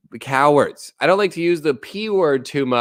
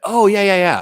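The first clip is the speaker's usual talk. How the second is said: high, loud yeah yeah, imitating someone nodding along; mock eager agreement; 8 semitones higher, 4 dB louder than usual. Imitating Chinese people agreeing without getting it, mock eager. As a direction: chirp it out, eager and oblivious. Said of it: "Chinese people are like".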